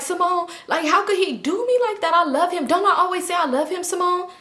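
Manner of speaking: high-pitched mock pleading; imitating a friend's high-voiced appeal; retold pleading.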